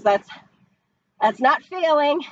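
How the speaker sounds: ordinary delivery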